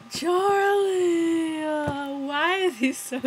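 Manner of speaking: loud, high drawn-out whine; theatrical heartbroken cry-out; dismayed wail. Real, not acted. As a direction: wail in sympathy for him. Upset that Charlie is sad.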